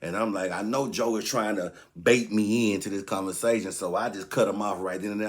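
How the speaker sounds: normal talking voice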